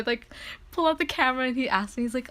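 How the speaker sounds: normal talking voice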